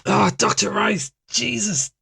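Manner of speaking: startled, slow and high; jolted gasp of surprise. Acted. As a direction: caught off guard by a sudden noise. Startled by a loud noise.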